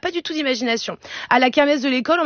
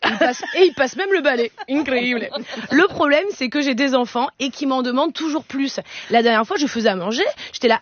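ordinary talk

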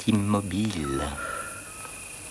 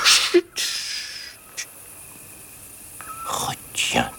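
whispering; much slower than usual. Acted. Shushing to keep air still.

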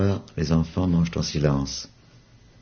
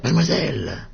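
high calling cry; 10 semitones higher than usual. Acted. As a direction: call her from afar. Ernest appelle Yvonne. (appel aigu vers mademoiselle)

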